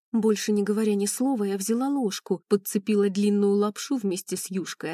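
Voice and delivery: plain reading voice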